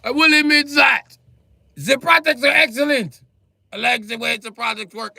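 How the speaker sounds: high, loud, fed-up concession; exasperated yelling. Real, not acted. Ranting at German makers, yelling the grudging praise. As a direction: shout the praise through clenched frustration.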